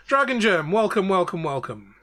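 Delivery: lightly voiced welcoming herald; a hint of a grand city greeter. NPC greets party at the city.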